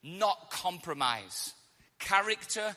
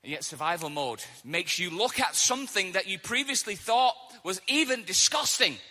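ordinary talk

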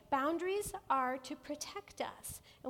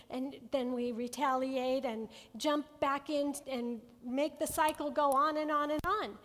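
normal talking voice

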